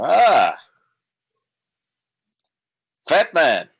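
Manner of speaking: high, loud greeting; hammed-up hearty greeting; pompous hail. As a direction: call out grandly across the table. Character hails the gentleman.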